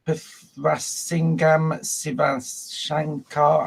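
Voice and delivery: very slow, halting "Pithrasingam Sivashankar"; struggling pronunciation. Real, not acted. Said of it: "terribly sorry about that pronunciation".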